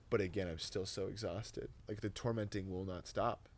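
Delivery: normal talking voice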